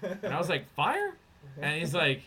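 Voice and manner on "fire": high, sharply rising question; feigned disbelief. Retold or imitated. Son playing dumb to his father, incredulous. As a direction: echo it back, pitched way up in doubt.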